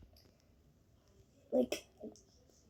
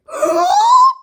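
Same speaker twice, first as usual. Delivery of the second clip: sharp high gasp of shock; 10 dB louder than usual. Retold or imitated. Kid retelling game shock, sharp high gasp. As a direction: gasp in disbelief.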